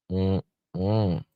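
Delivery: ordinary delivery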